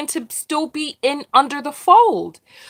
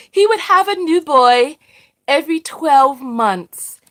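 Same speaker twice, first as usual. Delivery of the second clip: ordinary delivery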